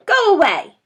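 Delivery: high, loud angry snap; snapping rat. Acted. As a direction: snap it at the intruder. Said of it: "it snapped".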